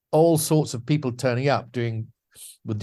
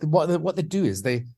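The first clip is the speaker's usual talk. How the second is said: ordinary talk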